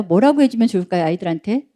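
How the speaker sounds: usual speaking voice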